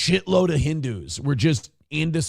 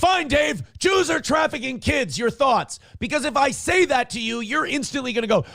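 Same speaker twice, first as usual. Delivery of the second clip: high mock challenge; 10 semitones higher than usual. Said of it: "addressing Dave mockingly".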